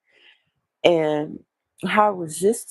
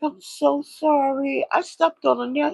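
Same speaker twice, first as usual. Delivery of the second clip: apologetic; 10 semitones higher than usual. Retold or imitated. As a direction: plead sorry in a raised, sympathetic voice. Retold apology while comforting him.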